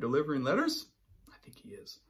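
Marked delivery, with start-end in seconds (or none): 1.3-2.0 soft breathy aside; hushed whisper. Real, not acted.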